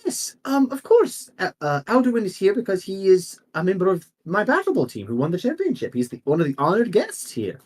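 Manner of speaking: high, fluttery apology with an exclamation; flustered noble lady. Acted. Noble lady apologizes to the priest, high and flustered.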